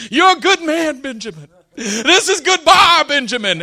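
high, hammed-up tearful goodbye; mock crying. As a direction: imitate a weeping great-uncle, wailing at the start of each phrase. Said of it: "he walked off crying".

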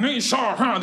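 mock Cantonese, rough and high; imitating rough Cantonese talk; rough Cantonese imitation. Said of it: "they had that real rough".

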